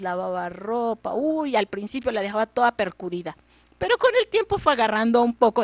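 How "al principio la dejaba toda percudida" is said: ordinary delivery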